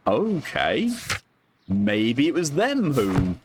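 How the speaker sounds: ordinary talk, nothing marked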